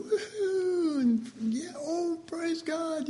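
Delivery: excited cheering. Retold or imitated. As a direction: cheer like a crowd getting presents. Family cheering over gifts.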